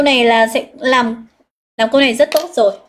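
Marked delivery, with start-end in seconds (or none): none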